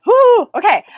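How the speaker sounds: a high, loud burst of excitement; excited whoo. Real, not acted. Getting excited about the dimensions, letting out a high whoo. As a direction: let the excitement spill out, then catch yourself. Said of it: "I'm getting excited".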